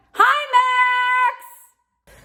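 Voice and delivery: very high, loud hello; calling out a greeting. Real, not acted. Teacher interrupts herself to greet Max, calling out high and loud. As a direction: sing out a hello to someone at a distance.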